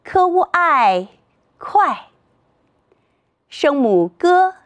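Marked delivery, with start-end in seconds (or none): 0.0-2.1 "科乌爱快" slowly, high "科乌", falling "爱" and "快"; slow three-part blend "科乌爱快" into fourth tone; pinyin blending. Shown as a demonstration. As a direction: sound out "科乌爱", then join as falling "快". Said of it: "再读一遍".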